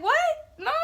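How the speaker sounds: very high, loud cry; shocked disbelief. Retold or imitated. Daughter hearing she is pregnant, shocked high cry. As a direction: yelp it out in shock.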